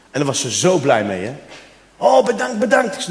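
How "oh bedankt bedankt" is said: high, gushing thanks; delighted cleaner. Retold or imitated. Cleaner thrilled by the gift. (hoog en uitbundig dankbaar)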